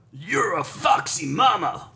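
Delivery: high, slow, put-on line reading; sultry movie line. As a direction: ham up a cheesy movie line.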